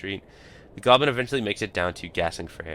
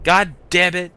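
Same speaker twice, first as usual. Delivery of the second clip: yelling curse; 10 semitones higher, 9 dB louder, slower than usual. Acted. Companion yelling after griffin attack.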